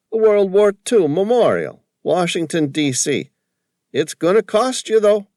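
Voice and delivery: high, loud broad East Coast cabbie voice. A narrator's reading. Cabbie calling to Sam from the taxi, broad East Coast accent. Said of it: "the cabbie warned".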